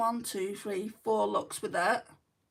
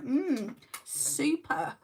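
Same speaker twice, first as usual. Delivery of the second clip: sarcastic; 5 semitones higher, much slower than usual. Retold or imitated. Acted reaction to unwelcome surgery news.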